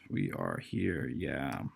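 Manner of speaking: low voice. Acted. GM odd low voice aside.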